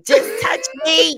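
loud high clingy plea. Retold or imitated. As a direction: beg to be touched. Clingy demand acted out.

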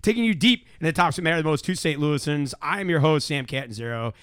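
energetic live-show opener; show host intro. Acted. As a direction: rattle off the show's welcome with punch.

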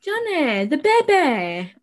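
dramatic wail at Johnny about the bebe; Catherine O'Hara impression. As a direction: Moira Rose scolding Johnny about the crying bebe. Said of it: "my Catherine O'Hara impression".

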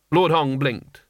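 plain reading voice

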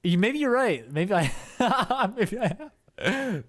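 high, breaking into laughter; laughing. Real, not acted. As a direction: laugh through the words. Laughing at suppressed-memory joke.

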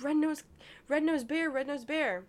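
imitated insistent grandmother. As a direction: play a grandmother stubbornly repeating a name. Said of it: "and she's like".

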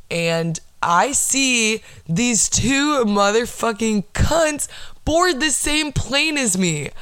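slow, scandalized reveal rising high in pitch; drawn-out outrage. Retold or imitated. Telling listeners the line cutters boarded the same plane, drawn-out outrage. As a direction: stretch out each word in indignant disbelief.

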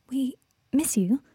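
tentative, pausing after the first word; hesitant. A narrator's reading. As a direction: venture it hesitantly, unsure of the welcome. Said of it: "she said it hesitantly".

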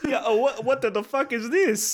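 loud, high mock-Italian outburst; imitated Italian accent. Copying his friend's restaurant complaint, hammed-up Italian accent. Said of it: "why'd you get Italian?".

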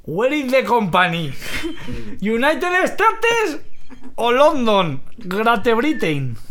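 put-on slow, halting English in a thick Spanish accent; hammed-up Spanish-accented English with rising pitch. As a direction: struggle through English like a Spaniard. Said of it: "hablando inglés, con acento español".

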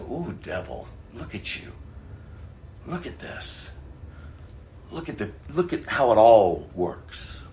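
soft, sly taunting voice; hushed mocking taunt. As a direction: tease the devil under your breath. Preacher addresses the devil.

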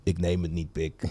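imitating a friend's low, soft mutter; low mumbled refusal. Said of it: "Hij zegt".

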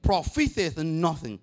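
slow, loud "The flesh profiteth nothing"; preacher reading Jesus' words; proclaiming scripture. Acted. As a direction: declare it word by word.

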